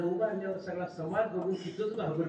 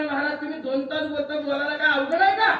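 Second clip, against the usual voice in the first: mocking villager; 8 semitones higher, 7 dB louder than usual. Villager challenging the preacher.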